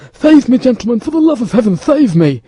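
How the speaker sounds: high, loud, desperate pleading; panicked cry for help. A narrator's reading. Terrified mercer begging the musketeers to save him, frantic cry. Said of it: "cried he".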